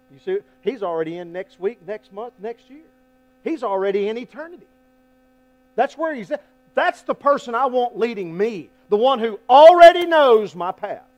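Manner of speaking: loud, high, driving preacher voice; preaching at full force. Acted. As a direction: preach it out hard to the room. Preacher building again.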